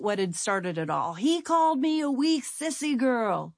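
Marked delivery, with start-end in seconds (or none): 1.1-3.5 high, tearful, slowing at the end; a child sobbing out the insult; choked out through tears. Retold or imitated. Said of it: "And through tears, I choked out".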